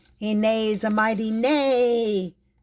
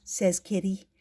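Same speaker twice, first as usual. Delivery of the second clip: usual speaking voice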